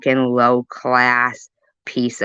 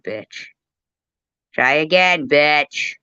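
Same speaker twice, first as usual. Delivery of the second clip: usual speaking voice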